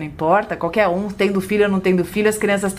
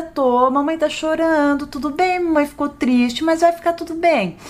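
put-on high, soothing sing-song to a child; tender motherly voice, pitched up; reassuring mother; 7 semitones higher than usual.

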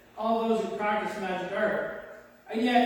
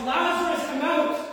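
raised, higher calling voice; loud call; 7 semitones higher, 4 dB louder than usual. A narrator's reading. Preacher reads Jesus calling Lazarus from the tomb, loud call. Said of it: "he called in a loud voice".

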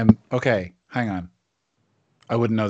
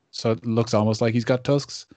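ordinary talk, nothing marked

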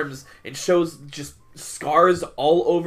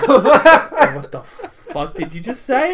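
loud, high, slow disbelief through laughter; mock incredulous laughing; 7 semitones higher, 7 dB louder, much slower than usual. Imitating coworker's reaction to the preacher, incredulous laughing. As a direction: laugh out the outraged question. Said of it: "my friend was like".

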